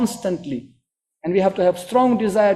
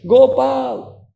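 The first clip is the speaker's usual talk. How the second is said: calling out; 5 semitones higher, 4 dB louder than usual. Retold or imitated. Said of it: "his enthusiastic search and screaming".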